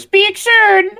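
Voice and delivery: very high, falsetto sign-off; message voice. Retold or imitated. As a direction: squeak it out like a tiny sprite. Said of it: "I can't even do the voice".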